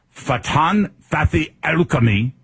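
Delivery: showy, drawn-out Arabic-accented name; exaggerated Arabic pronunciation. Shown as a demonstration. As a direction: ham up each Arabic name, slowly. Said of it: "I wanna pronounce it properly".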